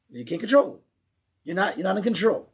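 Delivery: normal talking voice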